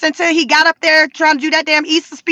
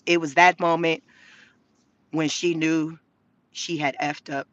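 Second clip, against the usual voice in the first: dramatic meme narration; 9 semitones lower, 9 dB quieter than usual. Pretend. Mocking Kamala with a hushed trailer voice, dramatic. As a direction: drop much lower and softer, like a movie trailer.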